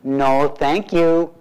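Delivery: drawn-out, mock-polite no thank you; a polite brush-off, very slow; slow polite refusal. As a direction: stretch each word into a prim refusal. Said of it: "They might say".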